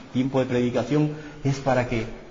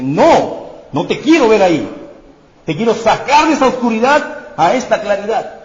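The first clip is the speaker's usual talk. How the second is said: high, loud, urgent plea; God speaks to the lost listener, high and loud; God calling out; 8 semitones higher, 8 dB louder than usual. Acted. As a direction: call them out of the darkness. God's voice calling out.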